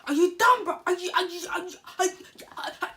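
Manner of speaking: put-on loud, high shout of the girl squaring up; girl shouting at the narrator, loud and high; aggressive girl. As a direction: yell it like she wants a fight. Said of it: "And she was like".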